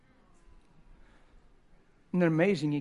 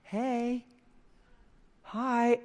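plastic greeting; 7 semitones higher than usual. Retold or imitated. Pastor mocks a fake cheerful greeting to a son. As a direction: fake bright high hello. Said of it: "get plastic and go".

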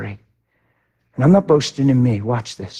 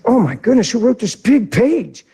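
surprised; 9 semitones higher than usual. Retold or imitated. Preacher finding the note in his Bible, surprised. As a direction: gasp it out, amazed. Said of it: "I was like".

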